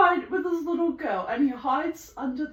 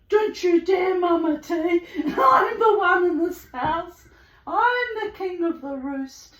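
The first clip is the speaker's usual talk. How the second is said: raised, louder imitated bossy retort; acted defiance; 5 semitones higher, 4 dB louder than usual. Bird telling Mama T who rules, loud mock-defiant retort. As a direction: proclaim it loudly, half laughing. Voicing the bird's defiant reply.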